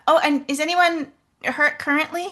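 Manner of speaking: high, loud eager question; calling out. Acted. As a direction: call the question across. Calling out across distance.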